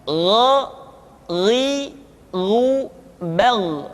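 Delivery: throaty Arabic ghain, full and heavy in "Gha, ri, ru, bal"; "Gha, ri, ru, bal" as separate heavy ghain syllables; thickened gha sound. Shown as a demonstration. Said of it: "suara kita mesti terdengar menebal".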